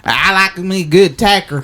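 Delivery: lightly hammed-up Texan accent; mock Texan accent. Showing how Texans talk, mock Texan accent. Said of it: "That's how Texans talk".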